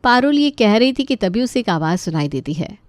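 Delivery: plain reading voice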